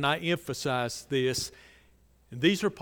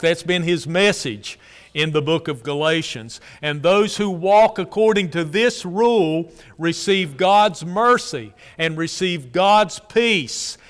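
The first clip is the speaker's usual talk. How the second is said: loud, ringing pulpit delivery; preaching at full force; 8 dB louder than usual. Acted. Preacher proclaiming Paul's words to the congregation, loud and fervent. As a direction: preach it out to the whole church.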